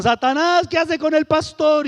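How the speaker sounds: high, loud mocking yell of "Satanás"; townspeople yelling. Townspeople jeering at the big convert walking with the pastor, loud yell. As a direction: shout it across the street at the pair. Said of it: "Y gritaban".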